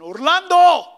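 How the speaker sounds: loud, stern call of the full name; stern mom calling out. Retold or imitated. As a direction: call him like a mom who means business. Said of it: "they even say it with a different tone of voice".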